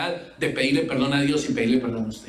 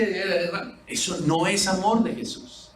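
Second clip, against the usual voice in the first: normal talking voice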